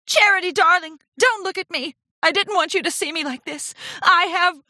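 high, tragic drama-queen plea; theatrical anguished confession; melodramatic distress. A narrator's reading. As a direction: plead as if utterly ashamed. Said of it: "Rarity cried".

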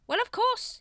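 very high, loud bluff; indignant high-pitched assurance; blustering. A narrator's reading. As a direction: cover ignorance with bluster. Grand Master blusters.

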